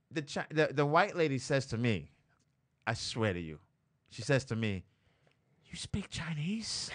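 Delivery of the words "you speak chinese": slow, drawn-out whisper; hushed question. Retold or imitated.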